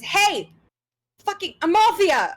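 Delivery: high yell from up the stairs; a stable hand shouting down at Amalthea; angry call down. Acted. Guard yells down at the horse girl, high and angry.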